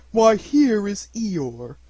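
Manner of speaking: high, loud, drawn-out greeting; welcoming. A narrator's reading. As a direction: greet a friend with slow delight. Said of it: "Pooh making welcoming noises".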